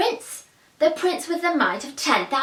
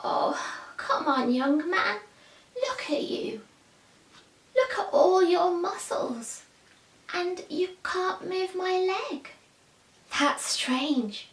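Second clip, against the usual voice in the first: put-on disappointed old man; 7 semitones higher, 4 dB quieter than usual. A narrator's reading. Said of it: "looked at him disappointed".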